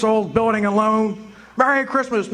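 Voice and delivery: ordinary talk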